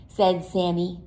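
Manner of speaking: ordinary delivery, nothing marked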